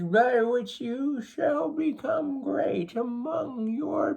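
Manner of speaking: put-on very high, slow, solemn old grandfather; grandfather's voice. A narrator's reading. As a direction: speak slowly from far above, like a spirit elder. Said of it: "The grandfather spoke".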